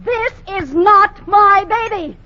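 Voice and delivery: put-on indignant shout at the man who brought the baby; angry refusal. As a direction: push the words out in outrage.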